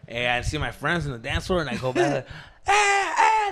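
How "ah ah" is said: high whooping call; party whoop. Retold or imitated. Greeting friends on the dance floor, high whoop. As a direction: cheer like you just got away with it.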